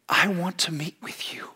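soft whispered invitation; tender whisper. Acted. God inviting the hidden one to meet, whispered. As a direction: lean in and whisper it.

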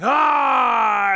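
loud high jump-scare shout; sudden monster yell; scare actor shout. Retold or imitated. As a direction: leap out and yell to frighten. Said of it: "the scare actor would be like".

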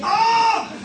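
sharp high yelp, loud; yelp of pain. Retold or imitated. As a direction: cry out as the shock hits. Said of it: "I just went".

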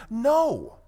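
"no" punched high and loud, then dropping; a loud standalone "no" that starts high and falls hard; emphatic no. Real, not acted. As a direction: land a firm, emphatic "no" on its own. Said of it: "I just wanna say emphatically".

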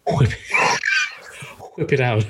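"whip" with a blown HW start; exaggerated wh. Shown as a demonstration. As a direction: demonstrate a big puff into "whip". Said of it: "I can't pronounce it like you guys".

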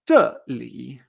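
low "zheli", dropping then dipping up; fall, then curved tone. Shown as a demonstration. Teacher showing the curved tone, "zheli" falling then curving. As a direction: drop "zhe" and bend "li" upward from low. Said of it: "este tono curvo".

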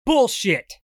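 sudden furious shout; angry outburst. Acted. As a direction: explode with rage. Husband explodes at news.

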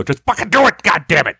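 high-pitched strained angry outburst; exasperated snapping. Acted. Man to waitress who asks why, furious mock outburst. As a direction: blow up at someone who questions your order.

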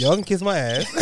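playful defiant laugh; laughing retort. Real, not acted. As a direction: fire it back through a laugh. Teased about grits, laughs back.

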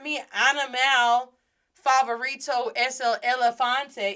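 animal and elefante with American English vowels; mispronounced Spanish. Shown as a demonstration. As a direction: imitate a learner's English-sounding elefante. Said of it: "That is not well pronounced".

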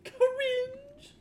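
very high, teasing falsetto; mock squeal. Teasing repeat of the verdict.

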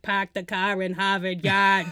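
hammed-up Boston accent, R's dropped hard; playful Boston accent mocking a bad actor. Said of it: "Boston accent".

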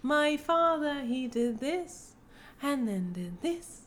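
slow, high, stilted mock British accent; Drew Barrymore. Mimicking the actress in a romantic scene, stilted British accent. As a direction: imitate a halting, overcareful British accent. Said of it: "it was just like".

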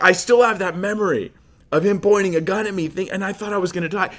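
high-pitched mock distress; traumatized protest. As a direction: act shaken by the memory, voice pushed up. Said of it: "the emotional trauma is not over".

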